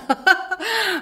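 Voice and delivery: high teasing laugh; mocking laugh. Young widow laughing at zio Jaco. Woman laughs at old man.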